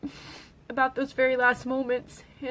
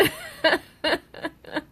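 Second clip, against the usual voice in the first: a burst of real laughter, louder; a quick bright chuckle; short laugh; 5 semitones higher, 5 dB louder than usual. Real, not acted. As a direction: laugh it off fondly. Wry laugh after cussing remark.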